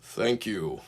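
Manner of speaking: soft, stiff thanks; quiet, rigid politeness. A narrator's reading. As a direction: thank him as stiffly as possible. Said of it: "in the stiffest possible voice".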